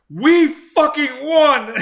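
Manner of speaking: shouted, high and loud; shouting in all caps. Retold or imitated. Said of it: "in all caps".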